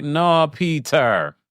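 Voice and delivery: slow, drawn-out Jamaican "no"; Jamaican accent. Retold or imitated. Host voicing fellow Jamaicans reacting to Peter, in a Jamaican accent. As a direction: imitate dismayed Jamaicans, stretching the words. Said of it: "the Jamaicans are like".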